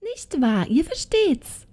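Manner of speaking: high witch's call, rising to a question. A narrator's reading. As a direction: call to the box in a high crone voice. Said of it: "klopfte dann auf die Schachtel und rief".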